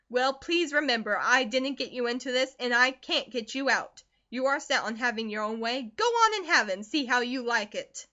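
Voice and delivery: loud, hard mother, flaring on "Go on"; cold angry mother. Acted. Mother refuses to help, loud and cold. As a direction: wash your hands of her, loudly. Narrator voices Mrs Comstock.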